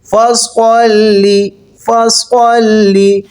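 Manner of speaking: فَصَلِّ with a fat, heavy saad, slow; thick saad. Shown as a demonstration. Teacher models the heavy saad in فَصَلِّ. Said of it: "اس کو موٹا کر کے پڑھیں گے".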